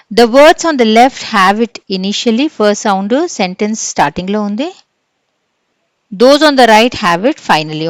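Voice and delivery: loud, slow reading of the question; quiz reading. Acted. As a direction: announce the question to the class. Reading textbook aloud. (తరగతికి ప్రశ్న బిగ్గరగా చదవడం)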